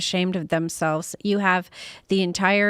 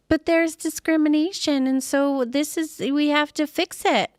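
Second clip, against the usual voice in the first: high whiny mock voice; mocking quote; 7 semitones higher than usual. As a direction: mimic them as naive. Mocking opponents' claim.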